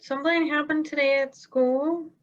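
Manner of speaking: a hint of a nervous kid owning up; kid confessing to parents. Kid tells Mom and Dad about school, nervously hesitant.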